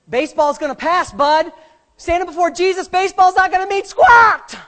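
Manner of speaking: high, loud warning building to a shout; full-force preaching. Real, not acted. Pastor telling his son baseball will pass, full-force preaching. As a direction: drive it home, shouting the last line.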